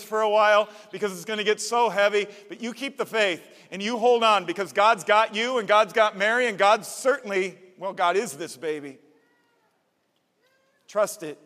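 rally him with booming conviction; bold angel reassurance. Acted. Angel urging Joseph to keep faith, loud and fervent.

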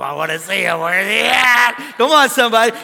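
eager calling out. Retold or imitated. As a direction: call out hungrily for the leader. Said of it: "I want him to go".

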